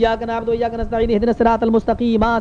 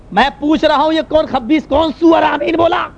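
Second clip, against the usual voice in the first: imitating angry imam; 7 semitones higher, 3 dB louder than usual. Imam asks who said Ameen, high angry shouting. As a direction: demand an answer, stretching the last words. Imam angrily demands the culprit.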